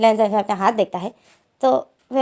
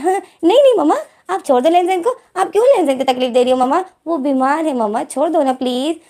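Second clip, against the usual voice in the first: frantic pleading; 6 semitones higher than usual. Acted. Wing begs mother to stop hurting Lenzen, high and frantic. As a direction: plead hard to save your brother.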